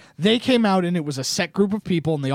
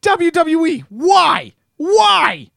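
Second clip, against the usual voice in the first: exasperated rant; 9 semitones higher, 4 dB louder, much slower than usual. Real, not acted. Ranting at WWE.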